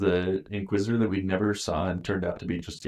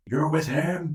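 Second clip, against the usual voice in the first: Anakin; 9 semitones higher, slower than usual. Retold or imitated. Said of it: "and Anakin's like".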